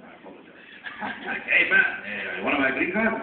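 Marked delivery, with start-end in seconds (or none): 1.5-3.1 loud, high, hammed-up Mexican accent; a deliberately bad Mexican accent, played loud. The green card seller pitching to the actors, in a bad Mexican accent. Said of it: "I'm gonna do a really bad Mexican accent".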